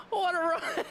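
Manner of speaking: high, thrilled exclamation; excited exclaim. Real, not acted. Streamer reacts to the stage winner, excited exclaim. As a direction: cheer the finish. Stage win just happened.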